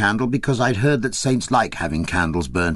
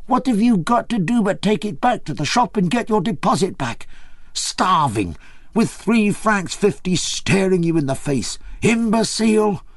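excited scolding; 10 semitones higher than usual. A narrator's reading. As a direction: scold him, dancing with glee. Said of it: "She got so excited".

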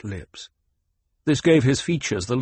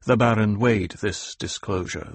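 usual speaking voice

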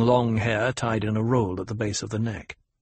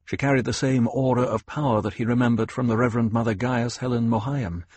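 ordinary delivery

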